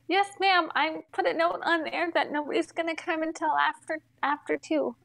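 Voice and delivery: high, meek agent voice; imitated phone girl. Girl answering the cursing customer, high compliant imitation. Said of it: "this is me to this girl".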